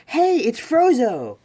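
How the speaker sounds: loud, high, drawn-out hail; calling out. Acted. Ochako walks in hailing a classmate, calling out. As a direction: holler a nickname across the room. Retold girl calling out nickname.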